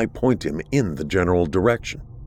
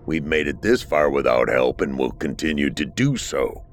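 low resentful creature voice; 5 semitones lower than usual. Acted. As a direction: hostile and low, full of grudge. Creature rants against mainland.

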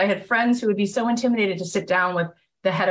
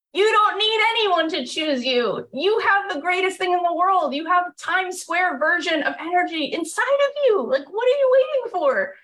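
much higher, building passion toward the final question; rousing pep talk; 10 semitones higher than usual. Real, not acted. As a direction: fire up the audience to chase their dream. Rousing motivational climax.

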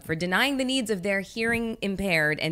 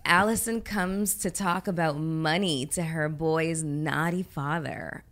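slow, put-on seductive reading; mock sexy voice; slower than usual. Host reading another porn title, slow and sultry. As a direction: linger on each word suggestively. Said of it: "read it in your most sexual voice".

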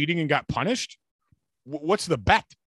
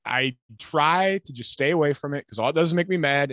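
usual speaking voice

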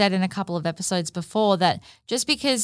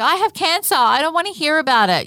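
much higher, louder snap of frustration; exasperated outburst; 11 semitones higher, 6 dB louder than usual. Retold or imitated. To a friend venting about her boyfriend, exasperated. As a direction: blurt it out, fed up and bitter. Imitating her own resentful reply.

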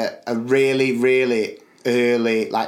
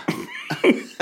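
breathy laugh; 4 dB quieter than usual. Real, not acted. Laugh after punchline.

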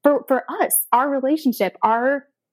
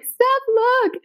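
excited; 14 semitones higher than usual. Retold or imitated. Friend's excited engagement text quoted.

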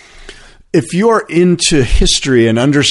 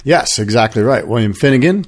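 ordinary delivery

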